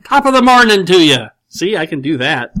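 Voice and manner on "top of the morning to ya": Irish accent, pitched high and loud. Shown as a demonstration. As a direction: belt out a stock Irish greeting accent. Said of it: "Say something with an Irish accent".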